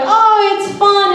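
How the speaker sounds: high sing-song "oh it's fun"; mock cheerful. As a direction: fake breezy cheer. Mocking race officials.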